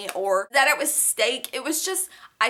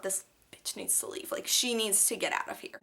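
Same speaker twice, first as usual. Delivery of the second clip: seething under breath; 3 semitones lower, 9 dB quieter than usual. Retold or imitated. Angry thought said to herself.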